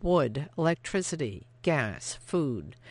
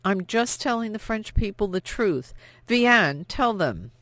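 desperate plea; 6 semitones higher, 5 dB louder than usual. Acted.

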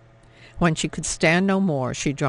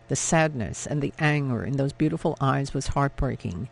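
usual speaking voice

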